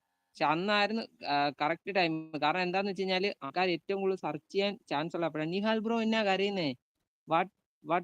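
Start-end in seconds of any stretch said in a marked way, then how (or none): none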